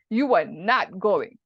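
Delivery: slow, firm, final order; emphatic forbidding. Shown as a demonstration. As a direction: spell out the ban word by word. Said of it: "it's more emphatic".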